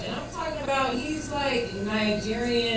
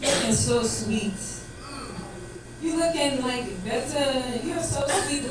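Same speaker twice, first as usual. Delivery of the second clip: lightly imitated Nigerian boyfriend sweet-talk; foreign sweet talk; 3 semitones higher, 6 dB louder, slower than usual. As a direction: coo it with a hint of Nigerian accent. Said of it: "in the foreign way, not like an American could".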